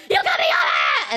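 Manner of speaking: harsh, drawn-out mock scream; imitated yelling. Truck driver yelling at the other driver, mock raging scream. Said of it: "like, yelling".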